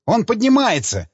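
the engineer sounds the alarm; alarmed cry. A narrator's reading. Engineer warns the crew, alarmed high cry. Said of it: "Тревога в голосе инженера".

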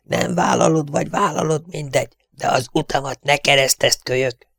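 high, wheezing strangled voice; Tigris. A narrator's reading. The Tiger warns the kid off, wheezing. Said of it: "sipolta a torszang".

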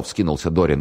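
plain reading voice

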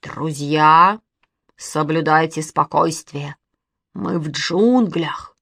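lightly put-on scary voice; slow, ominous announcement, mildly spooky. A narrator's reading. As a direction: play at frightening the little ones. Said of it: "страшным голосом сказал Костя".

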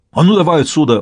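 angry shout to get lost; sudden fury. A narrator's reading. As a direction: snap into a rage. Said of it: "взъярился он внезапно". (яростно, внезапно)

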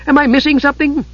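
high, excited little voice; breathless curiosity; eager kender. A narrator's reading. Tas lifting his head, eager and high.

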